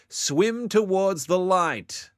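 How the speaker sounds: loud, high, drawn-out command; dramatic call. Acted. Dramatic chanted call in game.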